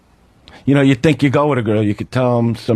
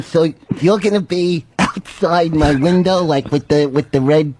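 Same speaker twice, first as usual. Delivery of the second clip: lightly imitated agent, slowed down; Dennis. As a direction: draw it out like a half-believing agent. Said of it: "and he's going".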